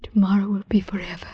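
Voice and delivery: quick hushed vow; whispered. Acted. To her lover at parting, fast whisper. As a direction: whisper it like a secret promise.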